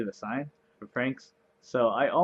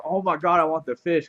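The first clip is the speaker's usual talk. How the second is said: higher and louder, thrilled; excited; 5 semitones higher, 7 dB louder than usual. Real, not acted. Gamer spots the fish deal and gets excited. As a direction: gush over a great trade.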